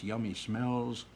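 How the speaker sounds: ordinary delivery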